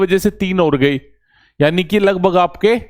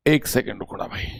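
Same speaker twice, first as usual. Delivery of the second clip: much softer, lower off-mic aside; quiet aside; 5 semitones lower, 9 dB quieter than usual. Real, not acted. Teacher pauses the recording, a soft low aside. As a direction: drop away from the mic and murmur it low.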